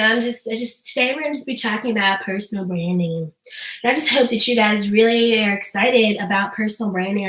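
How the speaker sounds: low monotone, drained of any excitement; unenthused livestreamer. Shown as a demonstration. Dull host greeting online viewers about personal branding, low bored monotone. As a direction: claim excitement in a low, lifeless drone. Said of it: "if you heard me talk like that".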